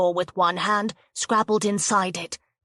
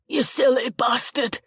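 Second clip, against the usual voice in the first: groaning; 5 semitones higher than usual. Acted. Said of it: "Jo groaned".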